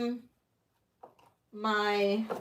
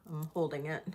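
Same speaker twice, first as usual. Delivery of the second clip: whispering; 6 semitones lower, 12 dB quieter, faster than usual. Real, not acted. Aside while holding item.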